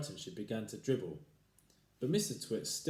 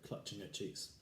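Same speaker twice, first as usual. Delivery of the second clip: ordinary delivery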